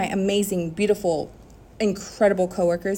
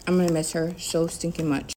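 ordinary talk

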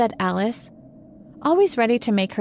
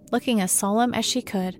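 ordinary delivery

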